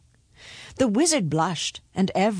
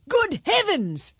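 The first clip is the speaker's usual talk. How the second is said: high, drawn-out exclamation; startled, high-pitched king; astonished king; 13 semitones higher, slower than usual. A narrator's reading. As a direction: cry out in royal alarm. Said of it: "cried the king".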